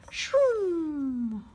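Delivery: high, loud whooshing jet noise. Acted. Reading to a child, imitating a passing jet. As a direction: make the jet zoom by. Jet flies past in book.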